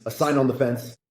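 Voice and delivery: normal talking voice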